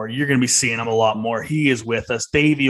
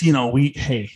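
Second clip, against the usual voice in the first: usual speaking voice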